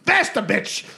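high, hammed-up impatient command; urging yell. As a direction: shout it to hurry her along. Drunk man urges hooker on.

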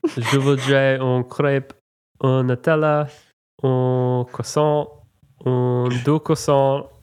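mock French ordering. Host recalling ordering in France, hammed-up French accent. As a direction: order pastries in clumsy tourist French. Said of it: "I tried my very best".

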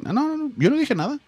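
high and soft, nervous denial. Retold or imitated. Denying to the lady, high and soft. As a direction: deny it quickly in a small high voice. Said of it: "I was like".

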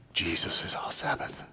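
hushed, reverent whisper; pious whisper. Pretend. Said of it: "in a very pious way".

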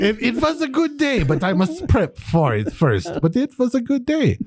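high, hammed-up German sing-song; German accent. Singing the hook on request, German accent. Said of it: "in your best German accent".

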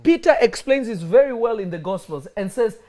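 loud, fervent preaching. Acted. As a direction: build the sermon to a shout. Preaching builds to full force.

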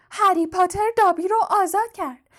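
sharp, high elf voice; piercing house-elf. A narrator's reading. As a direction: proclaim it in a shrill elf squeak. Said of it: "جن خانگی با صدای تیز و گوشخراشش گفت".

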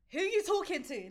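high-pitched challenge, imitating the auntie; retold confrontation. Auntie confronts a shouting woman, retold high-pitched challenge. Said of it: "was beefing this woman".

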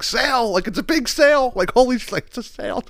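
high, excited sale-hype voice; mock hype gif. Mocking the seller's gif, high excited hype voice. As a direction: squeal it like a giddy ad. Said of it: "she put up a gif that said".

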